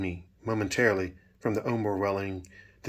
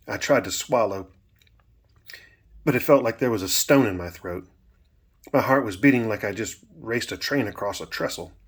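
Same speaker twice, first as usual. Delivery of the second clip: plain reading voice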